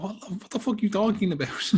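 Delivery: high, put-on voice of outraged confusion; student mocking a lecturer's claim, high incredulous voice; baffled student. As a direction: squeak it out in mock disbelief. Acted reaction to lecturer.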